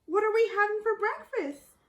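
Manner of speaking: put-on high, loud chirpy old lady; cheerful granny. Grandma opening the door, asking cheerfully. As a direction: ask brightly like a hungry granny.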